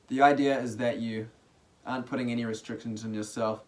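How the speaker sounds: soft, relaxed meditation guiding with long pauses; guided meditation. Real, not acted. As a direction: ease listeners into stillness, quietly and unhurriedly.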